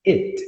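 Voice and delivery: "it" with a short, open vowel close to E; "it" pronounced like "et". Shown as a demonstration. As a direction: say "it" short and lax, almost "et". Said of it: "A gente fala isto".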